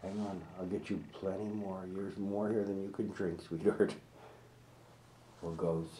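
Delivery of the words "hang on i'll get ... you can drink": ordinary talk, nothing marked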